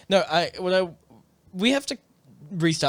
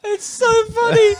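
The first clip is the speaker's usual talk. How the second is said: squeaky, slow, laugh-choked words; 8 dB louder, slower than usual. Real, not acted. Still cracking up telling her cohost, laughing. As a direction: squeeze it out through laughter.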